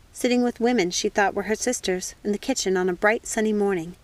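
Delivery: usual speaking voice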